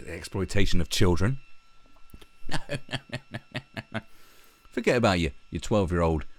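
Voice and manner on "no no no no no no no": high, laughing no no no. Real, not acted. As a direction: giggle out the refusals. Laughing mock refusal.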